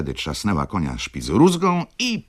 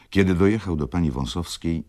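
ordinary delivery, nothing marked

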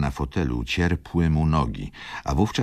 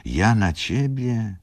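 slow, raised fond protest; affectionate disbelief; 8 semitones higher, slower than usual. A narrator's reading. Rzecki reassures his friend Wokulski, affectionate disbelief. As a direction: repeat it high and lingering. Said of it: "wpatrując się w niego z przywiązaniem".

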